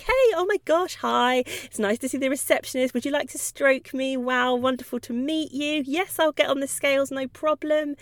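a delighted dog greeting everyone; happy dog at vet. Pretend. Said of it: "Some dogs march in with their tail up".

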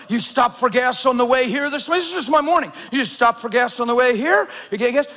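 ordinary delivery

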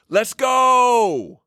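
team yell. Shown as a demonstration. Coach showing his program's catchphrase, high excited yell. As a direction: yell it long and high, drawing out GO. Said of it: "we yell".

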